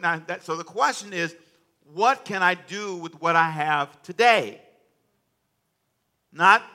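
loud, rousing preacher cadence; preaching at full force. Acted. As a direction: preach it out to the congregation.

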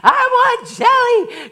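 put-on high, slow whiny child wail; a whining two-year-old; crying child. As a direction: play the toddler begging for jelly. Crying child voiced again.

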